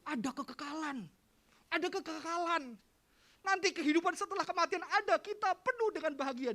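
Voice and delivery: loud, high proclamation; retold preaching. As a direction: proclaim eternity like a promise. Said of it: "ketika saya bilang".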